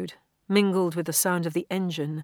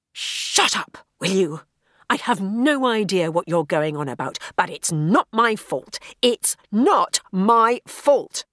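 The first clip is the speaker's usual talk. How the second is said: louder, insistent denial; loud and firm; 2 semitones higher, 7 dB louder than usual. A narrator's reading. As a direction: push back against the voice. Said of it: "She spoke loudly".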